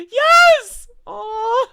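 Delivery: a high, loud squeal of joy; excited yes. Real, not acted. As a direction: burst out with real delight. Excited reaction to a fan name.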